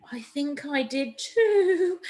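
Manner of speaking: high, slow trembling voice; quivery voice. A narrator's reading. Max agrees with the other thief, high and quivering. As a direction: quaver it out, spooked. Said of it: "said Max in a quivery voice".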